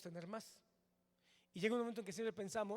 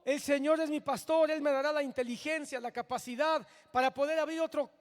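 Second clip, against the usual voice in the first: loud, high fervent preaching; preacher rallying a struggling congregation, loud and fervent; preaching at full force; 4 semitones higher, 12 dB louder than usual. Acted. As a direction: proclaim it like a revival preacher, loud and driving.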